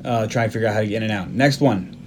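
normal talking voice

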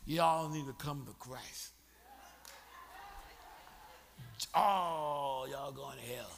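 low, slow mock sermon to the crowd; hammed-up new convert preaching at friends; mock street preacher. Converted drunk preaching at club friends, mock sermon.

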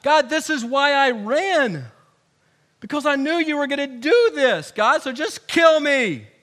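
fed-up, dramatic tantrum building to a loud last word; so mad. Acted. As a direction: whine angrily at God, drawing out the ends. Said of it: "He's so mad".

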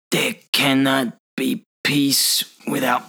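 slow, halting villain imitation; elderly-sounding villain impression. Host quotes the villain to his friends, slow and halting. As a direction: space the words out like an old man. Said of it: "You sound like an el".

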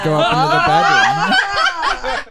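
breaking into high laughter mid-sentence; laughing. Real, not acted. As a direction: laugh through the words. Laughing through coworker story.

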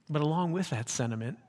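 normal talking voice

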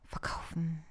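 breathy whispered word. Shown as a demonstration. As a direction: whisper it under your breath. Says the word twice, first whispered. (geflüstert)

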